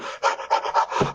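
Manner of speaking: quick breathy panting, out of breath. Acted. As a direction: pant like a scared runner. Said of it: "he stands there panting".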